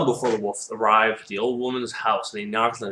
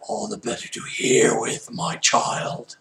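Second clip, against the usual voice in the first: put-on husky wolf-granny voice; wolf posing as grandmother; slower than usual. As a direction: rasp it like a disguised wolf.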